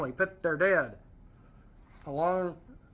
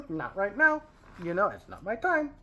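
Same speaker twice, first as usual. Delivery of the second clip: mock casual Jesus; 6 semitones higher than usual. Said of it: "he didn't do it like that".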